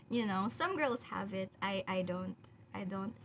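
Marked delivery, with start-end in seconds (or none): none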